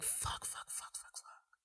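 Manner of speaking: rapid whispered string of curses; whispered panic while watching a horror film; panicked whisper. Retold or imitated. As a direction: whisper it in rising dread, barely audible. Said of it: "You're like".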